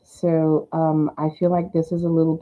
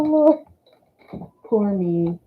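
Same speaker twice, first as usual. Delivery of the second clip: ordinary talk, nothing marked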